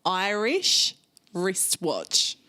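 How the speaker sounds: very slow, each word set apart; slow, deliberate articulation; careful tongue twister. Shown as a demonstration. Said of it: "my favourite tongue twister".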